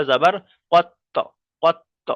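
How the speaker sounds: tajweed teacher demonstrating qalqalah on কত; qalqalah bounce. Shown as a demonstration. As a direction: hit the ত of কত hard and let it echo. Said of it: "কত বলে যে মাখরাজে, মাখরাজে গিয়ে লাগছে".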